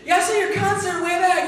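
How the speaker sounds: high, eager fan voice with a laugh; excited inmate. Retold or imitated. Prison inmate recognizing a rock star, excited. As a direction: gush like a starstruck fellow prisoner. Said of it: "the guy's got in there".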